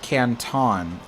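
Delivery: "Canton" with a full TAWN at the end; Canton, mispronounced on purpose. Shown as a demonstration. Reader shows how locals don't say it, "Canton" ending in "-tawn". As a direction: say "Canton" as can-TAWN, slow and open. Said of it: "we don't pronounce it as".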